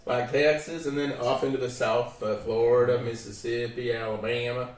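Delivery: lively Southern accent demo. Shown as a demonstration. Answering a request to make accents, Southern accent. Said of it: "like the Southern accent, they all talk like this".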